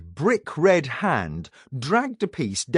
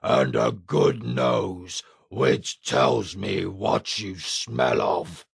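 lower, slow sniffing brute; deep giant voice; 5 semitones lower, slower than usual. A narrator's reading. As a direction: rumble it like a smug ogre. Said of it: "said the giant".

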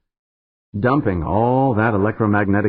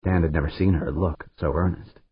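usual speaking voice

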